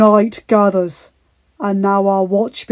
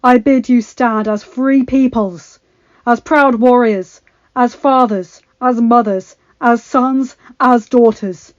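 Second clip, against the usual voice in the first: rousing battle speech; 3 semitones higher, 10 dB louder than usual. Acted. Jon on dragonback rallying the army, loud and stirring. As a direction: stir them to fight one last time.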